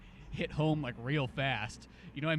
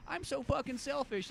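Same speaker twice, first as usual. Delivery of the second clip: high, slow mocking whine; mock selfish whine; 10 semitones higher, 4 dB quieter, slower than usual. Mocking a selfish wife in other movies, high whiny parody.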